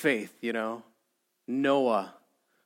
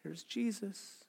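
much softer, slow, half-hearted delivery; passive murmur; 3 semitones higher, 16 dB quieter, slower than usual. Acted. Believers sitting passive as Jesus comes by, murmuring. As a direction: say it slowly and barely audibly. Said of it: "just going to be passive".

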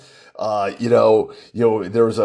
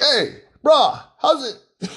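much higher, mock Hawaiian pidgin; Barack Obama; 11 semitones higher, slower than usual. Politician running into locals, slipping into Hawaiian pidgin. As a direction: greet a local in playful, high-pitched pidgin. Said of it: "natural pidgin language".

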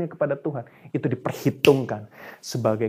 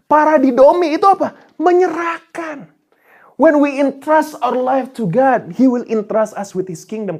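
full-force preaching; 7 semitones higher, 8 dB louder than usual. Real, not acted. Preacher urging the congregation to entrust life to God, high and forceful. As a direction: drive the point home at full pulpit force.

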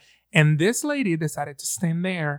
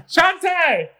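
high, loud hammed-up holler; mock call to coworker; 13 semitones higher, 5 dB louder than usual. Co-host echoes the store call to Shantae, high mock holler.

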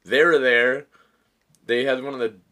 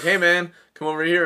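raised, higher storytelling call; calling someone over; 7 semitones higher, 7 dB louder than usual. Retold or imitated. Teller hails a guy to come over, calling out. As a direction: hail a guy from a distance.

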